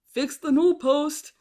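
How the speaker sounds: high, loud triumphant-style quote; mock Clark announcing the repair; imitated movie shout. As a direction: call it out big, quoting Clark. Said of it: "So he went".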